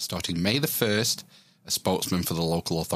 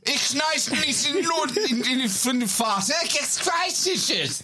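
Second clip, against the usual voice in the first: mock German accent; slower than usual. Spokesman yelling at the swimmer in a fake, high-pitched German accent. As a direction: play a ranting official in a made-up German accent, pitched very high. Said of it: "Do it in a German accent".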